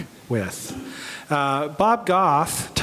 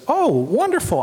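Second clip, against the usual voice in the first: eager yes; 9 semitones higher than usual. Retold or imitated. Bob accepts on the phone.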